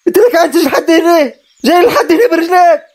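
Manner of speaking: high-pitched outraged challenge; angry confrontation. Acted. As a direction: confront him, pitch shooting up. Confronting the unwelcome cousin.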